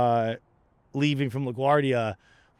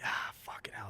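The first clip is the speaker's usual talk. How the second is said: much softer, breathless whisper; whispered curse; 15 dB quieter than usual. Retold or imitated. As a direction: whisper the agent's inner groan. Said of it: "you could tell he's just like".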